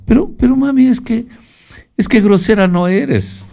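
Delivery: put-on high, squeaky child voice protesting to her mother; a whiny little girl pleading with mami; Chilindrina's voice. Speaker voicing Chilindrina answering her mother, high childlike whine. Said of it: "le dijo la chilindrina".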